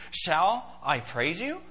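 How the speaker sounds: slow sarcastic question, rising at the end; sarcasm. Acted. Preacher showing Paul's sarcasm to the congregation, slow and sarcastic. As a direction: draw it out as a mock offer of praise. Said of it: "did you catch the sarcasm".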